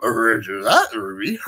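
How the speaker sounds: cartoon dog garble; Scooby. Dog answering his pal, garbled cartoon dog voice. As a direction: talk like a dog, R-heavy. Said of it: "there's Shaggy and Scooby".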